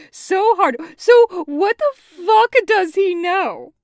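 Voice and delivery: laughing too hard. A narrator's reading. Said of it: "tears in his eyes from laughing".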